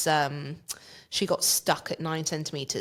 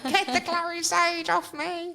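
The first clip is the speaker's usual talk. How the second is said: a strained, high, slowed cry to get it off; imitating her own pleading voice from the birth; high pleading demand; 12 semitones higher, slower than usual. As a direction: mock-desperate high plea in labour. Acted plea during labour.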